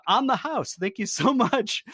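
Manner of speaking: playfully overdone gratitude; mock gratitude. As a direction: thank them with joking sarcasm. Sarcastic thanks for hotel porn channel.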